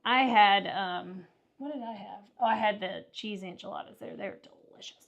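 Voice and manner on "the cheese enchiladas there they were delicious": breathy whisper. Real, not acted. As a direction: whisper it to yourself. Whispered aside about enchiladas.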